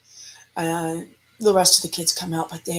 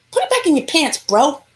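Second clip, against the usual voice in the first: high-pitched indignant order; mock scolding; 8 semitones higher than usual. Teller scolding the man at the fence, mock indignation. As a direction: tell him off in a raised pitch.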